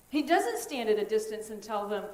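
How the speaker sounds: usual speaking voice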